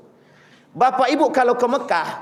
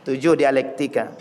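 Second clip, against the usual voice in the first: drawn-out, lower voice of sudden understanding; slow realizing Umar; 8 semitones lower, slower than usual. Retold or imitated. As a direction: let it dawn on him slowly. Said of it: "kata Umar bin Khattab".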